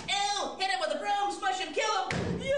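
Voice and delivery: high, loud disgusted yelling; shouting. Acted. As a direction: yell it like swatting a pest. People attacking snakes, shouting.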